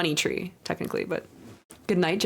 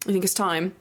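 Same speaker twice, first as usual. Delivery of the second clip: ordinary talk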